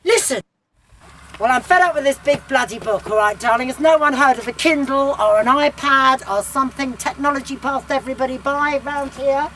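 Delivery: loud exasperated rant; fed-up outburst. Acted. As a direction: let rip, you've had enough.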